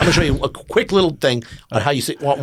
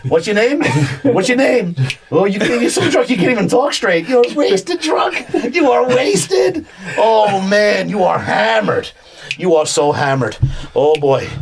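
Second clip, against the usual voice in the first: excited suggestion; 6 semitones higher than usual. Acted. Drunk suggestion.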